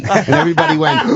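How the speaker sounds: ordinary delivery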